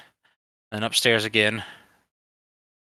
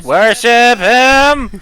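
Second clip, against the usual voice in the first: screeching robotic Dalek, much higher; 13 semitones higher, 12 dB louder, much slower than usual. Acted. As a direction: chant it like a Dalek. Dalek Emperor chant.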